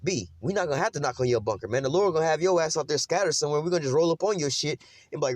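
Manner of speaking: usual speaking voice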